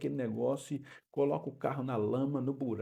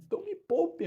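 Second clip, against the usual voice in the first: high-pitched, louder "spare me"; exasperated plea; 5 dB louder than usual. Real, not acted. Pastor pleading with the brothers to spare him, exasperated. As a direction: leap up high in mock exasperation.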